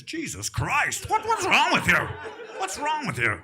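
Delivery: high, cracking outburst of mock horror; appalled father. Comic playing father reacting to mother, appalled high outburst. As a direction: exclaim as a hammed-up aghast dad. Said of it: "my father would react like this".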